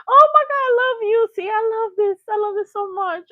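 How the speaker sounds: high, loud squeal of delight; excited gushing. Real, not acted. Host gushing at a fan's comment, delighted squeal. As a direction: gush over the fans, way up high.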